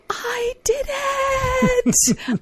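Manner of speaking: put-on high, breathless kid squeal; excited child scream. Mother imitating daughter's triumphant squeal. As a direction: squeal it like a thrilled five-year-old. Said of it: "she screamed".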